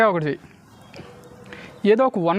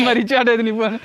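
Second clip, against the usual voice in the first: laughing; 5 semitones higher, 4 dB louder than usual. Real, not acted. Laughs after the trick succeeds.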